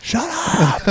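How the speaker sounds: high, hammed-up shout of shut up; retold yell. Yelling at a student who won't stop fake crying. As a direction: bark it like losing patience. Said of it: "and I yelled".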